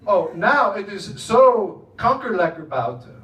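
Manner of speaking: slow, loud Dutch with a laugh; Dutch phrase. Retold or imitated. Quoting Dutch colleagues about the weather, slow loud Dutch. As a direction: savour the Dutch slang. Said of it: "Here's another one".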